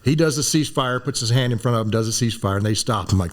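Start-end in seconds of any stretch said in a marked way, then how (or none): none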